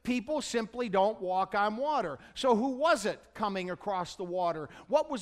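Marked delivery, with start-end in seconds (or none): none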